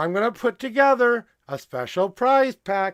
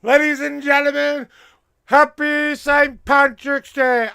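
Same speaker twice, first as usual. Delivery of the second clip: announcer greeting; 5 semitones higher, 5 dB louder than usual. Acted. Host greeting chat on Saint Patrick's Day, announcer style. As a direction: proclaim it like a host.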